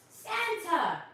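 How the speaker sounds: high, loud cry of Santa's name; Santa's arrival, shouted out; excited reveal. Real, not acted. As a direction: announce him like a surprise. Big reveal to children.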